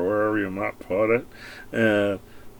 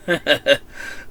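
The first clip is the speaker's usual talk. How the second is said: sarcastic laugh; 3 semitones higher than usual. Real, not acted. Laughs while mocking taxpayers.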